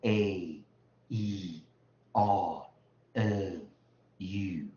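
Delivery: A E I O U recited as drawn-out letter names; long vowels. Shown as a demonstration. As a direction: hold each of A E I O U, pausing between. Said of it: "长".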